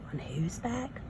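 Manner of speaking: unvoiced whispered question. Real, not acted. Teacher whispers a quiz question to the child. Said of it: "quiz whisper to child".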